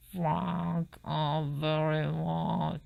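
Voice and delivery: slow, through clenched teeth. Acted. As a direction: force it out past locked jaws. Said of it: "through his clenched teeth".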